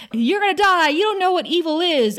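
high, loud taunting shout at an opponent; acted-out shouting. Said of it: "Faith is shouting at Angel".

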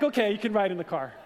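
quick, amused, laughing through it; laughing reply. Retold or imitated. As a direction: give in with a laugh. Laughing at kids' reason.

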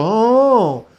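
high, drawn-out dazed "oh"; groggy wife's moan. Retold or imitated. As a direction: imitate a slack-mouthed waking groan. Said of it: "Her mouth just go".

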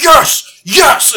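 high, loud dog elation; ecstatic shout. Retold or imitated. Dogs bursting out the back door, ecstatic shout. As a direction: shout it like a dog set free.

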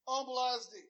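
ordinary talk

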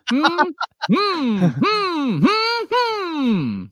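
very high, drawn-out hum; humming. Pretend. Co-host asked to try a hum, high playful humming. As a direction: hum long notes far above your speaking voice. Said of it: "Do you wanna try a hum it".

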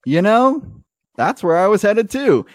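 high chipper driver joins them; gee-whiz NPC. Acted. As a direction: play the eager sidekick. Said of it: "He looks up and goes".

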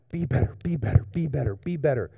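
imitated nagging chant in an altered voice; put-on voice of pushing yourself; mock self-pressure. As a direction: repeat it like a mock drill. Mimics self-pressure that doesn't work.

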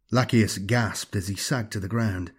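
plain reading voice

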